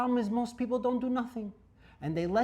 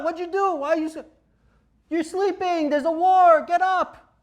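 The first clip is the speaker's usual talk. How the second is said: much higher and louder, jolted awake in panic; startled scream; 9 semitones higher, 8 dB louder than usual. Acted. Startled soldier wakes in a war, screaming in alarm. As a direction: yell like a man blasted out of sleep. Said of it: "He's gonna scream".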